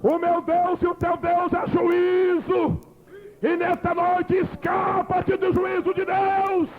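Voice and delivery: slow, shouted altar call; fiery preaching. Real, not acted. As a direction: preach it, urge them to flee judgment.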